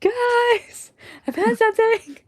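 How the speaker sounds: loud excited call to the group; excited shout. Acted. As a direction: shout it out eagerly. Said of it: "You're sneaky".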